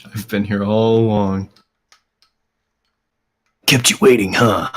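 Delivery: lightly voiced imitation of Snake; bad Snake impression. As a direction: do a half-hearted Snake impression.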